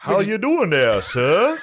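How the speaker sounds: high, slow put-on greeting of a slave reenactor; mock reenactor greeting. Imitating the reenactor greeting a tourist, high and slow. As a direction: sing it out high and slow like a hammed-up old servant.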